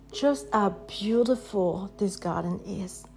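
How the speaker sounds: ordinary delivery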